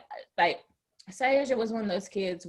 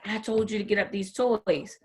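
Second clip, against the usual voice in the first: ordinary talk, nothing marked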